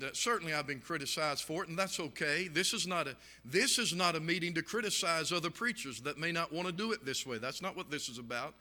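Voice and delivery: normal talking voice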